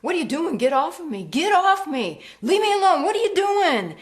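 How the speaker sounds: high, loud, desperate cries building to a scream; terrified pleading. Acted. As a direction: beg for your life as you're attacked. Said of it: "He said".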